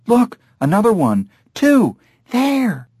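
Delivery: high, excited tourist calls; high-pitched bursts of discovery; excited spotting. A narrator's reading. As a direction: point them out with rising excitement.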